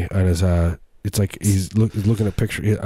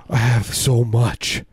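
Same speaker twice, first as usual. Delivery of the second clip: mock nervous; 4 semitones higher, much slower than usual. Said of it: "sweating nervously".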